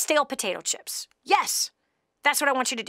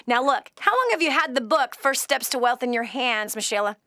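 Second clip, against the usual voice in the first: ordinary talk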